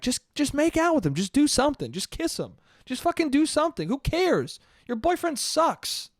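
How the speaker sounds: high-pitched pushy friend impression; imitated egging-on friend. Friend urging girl at the door, imitated egging on. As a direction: egg her on in a high mock voice. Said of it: "her friend is just telling her".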